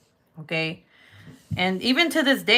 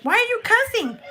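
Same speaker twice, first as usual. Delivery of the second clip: much higher, louder scolding question; mom scolding; 4 dB louder than usual. Retold or imitated. Mom to grown daughter, scolding, much higher and louder. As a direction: demand an answer. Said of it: "And then she's like".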